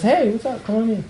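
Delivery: high-pitched acted greeting; lightly acted, upbeat hello; casual friendly welcome. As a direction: greet an expected friend at the door. Jesus welcomes someone at the door.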